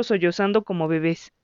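ordinary delivery, nothing marked

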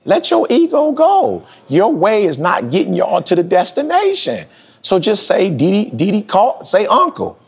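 retold self-talk, climbing high; self-scolding. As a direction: scold yourself until you cave. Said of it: "I was like".

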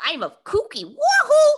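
high, loud, squealing kooky queen; sassy drag queen. Retold or imitated. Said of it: "Bob the Drag Queen's impression of Utica".

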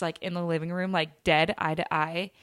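ordinary delivery

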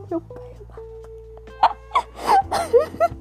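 high, slow wail of disbelief; exasperated cry. Real, not acted. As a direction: drag it out in despair.

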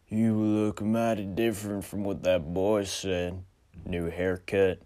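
western stranger drawl. Acted. Stranger jokes.

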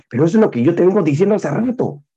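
high, fast, exasperated snapping; aggressive retort. Retold or imitated. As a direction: blurt it out, impatient and pushy. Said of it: "agresivo con mi tono y con mi voz".

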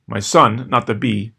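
plain reading voice

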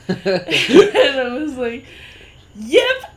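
laughing, then a loud high Yep. Real, not acted. Laughing at mom's punchline.

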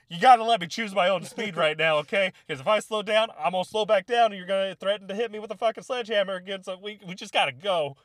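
high, loud, worked-up arguing; frantic mock protest. Retelling arguing with the platoon mid-run, frantic mock protest. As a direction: plead loudly in mock panic. Acting own protest while running scared.

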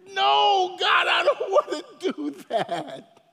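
whiny protest. Retold or imitated. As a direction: whine a laughing no to God. Acting a reluctant believer's protest.

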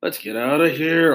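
ordinary delivery, nothing marked

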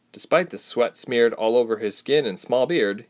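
plain reading voice

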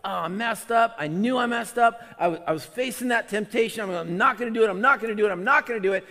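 high, loud, frantic lament; distraught self-reproach. Acted. Believer who gave in to temptation, frantic self-reproach. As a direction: beat yourself up out loud. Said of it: "You feel like".

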